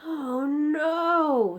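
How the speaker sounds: high, loud cry of alarm; sudden alarm at the jar; dismayed cry. Acted. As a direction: giant crying out in horror. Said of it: "he cried".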